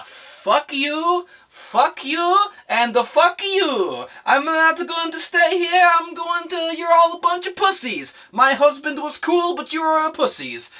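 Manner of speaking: high, loud mocking parody voice; mock Daenerys speech. Vlogger parodying Daenerys's speech to the khals, shrill mocking voice. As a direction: ham up her big defiant speech. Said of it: "she says".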